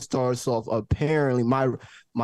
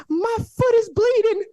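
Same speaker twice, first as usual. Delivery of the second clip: very high falsetto mock whine; hammed-up squeal of distress; wailing girl; 4 dB louder than usual. Storyteller imitating the limping girl's complaint, high mock wail.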